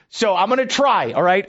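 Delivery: ordinary delivery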